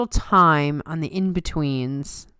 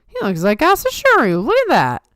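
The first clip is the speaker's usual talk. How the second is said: high, louder amazed exclamation; suddenly struck by the resemblance; surprised exclaim; 7 semitones higher, 4 dB louder than usual. Retold or imitated. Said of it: "all of a sudden I'm like".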